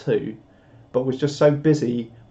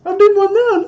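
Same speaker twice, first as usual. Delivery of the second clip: very high, loud squeak; put-on high-pitched voice, loud; 9 dB louder than usual. Shown as a demonstration. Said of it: "do a high voice".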